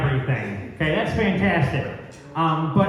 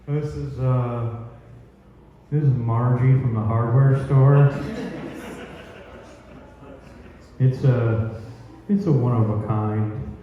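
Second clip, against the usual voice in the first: low, proud serial killer; low creepy killer voice; 6 semitones lower than usual. Retold or imitated. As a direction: answer low and pleased. Ed Gein answers the interviewer.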